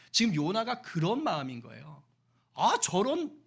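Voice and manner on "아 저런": loud, disgusted outburst; loud refusal. Acted. Jonah loudly refusing to befriend the enemy. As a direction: recoil and refuse.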